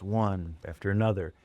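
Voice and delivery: ordinary delivery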